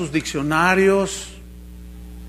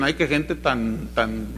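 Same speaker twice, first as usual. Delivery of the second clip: mock pious sarcasm; 6 semitones lower, 4 dB quieter than usual. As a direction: sneer at how spiritual they are. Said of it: "ustedes se ríen porque dicen".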